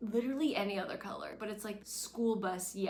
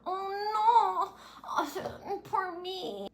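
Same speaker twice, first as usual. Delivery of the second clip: high, loud mock wailing; mocking whine; 10 semitones higher, 5 dB louder, much slower than usual. Mocking Lorelai's fake self-pity, high whining. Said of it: "she wants to complain about".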